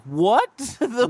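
high, slow, laughing what the fuck; laughing disbelief. Retold or imitated. Retelling staff telling him to leave, laughing disbelief. Retold outrage at restaurant staff.

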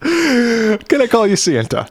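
high, slow overly polite; mock polite letter. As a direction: ask it with fake politeness. Continuing the letter bit.

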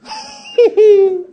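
high burst of laughter. Real, not acted. Preacher laughs at his own joke, high and free.

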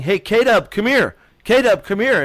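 mock a yell to summon someone over; imitated yelling. Rider retells a racing figure calling him over, mock yelling. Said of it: "and he's yelling".

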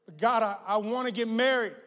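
pleading believer. Retold or imitated. A believer pleading with God to marry, loud. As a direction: beg God for marriage.

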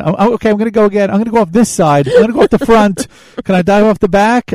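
high, fast, eager chatter; excited. Retold or imitated. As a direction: rattle it off, high and thrilled. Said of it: "And, and".

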